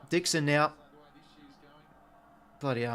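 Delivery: ordinary talk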